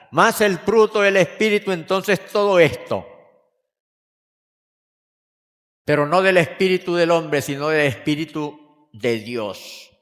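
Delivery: loud, high-pitched preaching swell; preaching at full force. Acted. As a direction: proclaim it to the whole church. Predica con fuerza. (predicación a toda voz)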